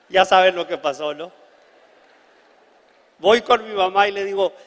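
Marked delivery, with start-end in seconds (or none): none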